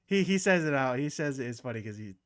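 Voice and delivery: ordinary talk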